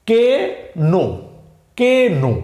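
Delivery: "কে নো" broken into kay, then no; K pronounced. Shown as a demonstration. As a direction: spell out the silent K aloud in "কে নো". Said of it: "এরকম হয়ে যাবে না?".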